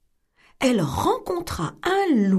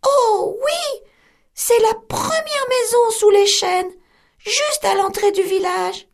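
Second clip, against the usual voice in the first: put-on much higher, sweet child's voice; little girl voice; 12 semitones higher, 4 dB louder than usual. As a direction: answer brightly like a trusting little girl. Girl answers.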